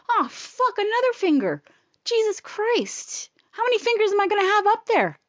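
high-pitched, fed-up outburst; exasperated. Retold or imitated. Acting exasperation at doctor's repeated exam.

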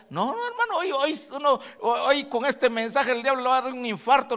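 high exultant cry; triumphant shout. Real, not acted. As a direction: rejoice loudly over the devil. Preacher's triumphant exclamation.

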